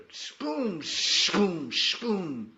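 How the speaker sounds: high, loud booming thunder noise; imitated thunder. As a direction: make the sky boom three times.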